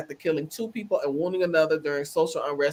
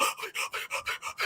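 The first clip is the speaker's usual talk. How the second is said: voiceless fake gasp; mock gasp from the stand; mock gasping sob; 11 dB quieter than usual. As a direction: mime the staged sob. Mocking his lip-sucking sobs.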